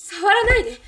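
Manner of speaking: loud, high cry pushing him away; sharp refusal. Acted. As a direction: shout it to stop his hand. Girl fends off groping policeman.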